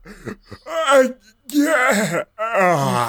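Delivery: badly faked yawn, hammed up; fake yawn. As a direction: stretch the words into a fake yawn. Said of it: "fake yawn I've ever heard in my life".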